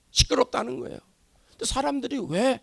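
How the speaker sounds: ordinary talk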